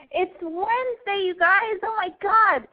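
high, bright excited host; gushing Wednesday greeting; bubbly normal voice. Real, not acted. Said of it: "Back to my normal voice".

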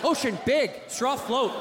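caveman shouting. Acted. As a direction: bark it like a caveman explaining. Caveman physics parody.

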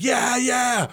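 high-pitched mock kid's cheer; imitated child's cheer. Parent imitating the child joining the yelling, excited cheer. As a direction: cheer along like an excited kid.

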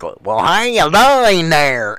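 slow, drawn-out fake southern accent; a northerner badly faking a southern accent; mock southern accent. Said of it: "trying to get that accent".

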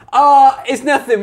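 loud, breezy brush-off; dismissive brush-off. Retold or imitated. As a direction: wave it away like nothing's wrong. Paladin brushing off a murder.

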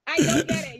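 laughing disbelief. Real, not acted. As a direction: talk through laughter. Laughing while speaking.

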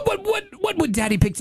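ordinary talk, nothing marked

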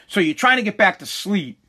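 ordinary talk

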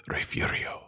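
"Refugio" breathed soft and slow, ref-YOO-ree-oh; cool pronunciation. Shown as a demonstration. Host showing off his favored way to say the town, hushed and slow. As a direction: draw "Refugio" out in a hushed, savoring way. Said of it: "'cause it sounds cooler that way".

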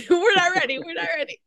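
high, laughing echo; laughing protest. Real, not acted. Laughing along, echoing the co-star.

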